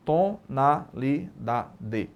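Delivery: "Tonalidade" very slow, split into separate syllables. Shown as a demonstration. Teacher counting syllables for the class, "Tonalidade" slowly syllable by syllable. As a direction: break "Tonalidade" into syllables with a pause after each. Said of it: "Cada emissão de som é uma sílaba".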